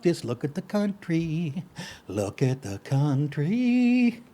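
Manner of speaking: slow, soft despairing sigh of words; defeated muttered lament; weary lament. Real, not acted. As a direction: let it sag in despair.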